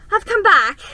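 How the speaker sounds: put-on high loud girl's call. A narrator's reading. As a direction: call out joyfully. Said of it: "she repeated".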